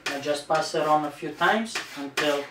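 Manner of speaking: normal talking voice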